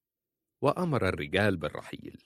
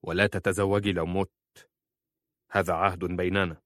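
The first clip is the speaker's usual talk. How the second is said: restrained, forceful demand; firm and solemn. A narrator's reading. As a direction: bind her to a promise firmly. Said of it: "ثم قال في قوة".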